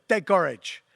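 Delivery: put-on quick, punchy Liberian-accented cry. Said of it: "that's my best Liberian accent I can give".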